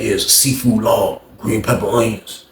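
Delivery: low chef voice. Retold or imitated. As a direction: drop into the chef's low easy voice.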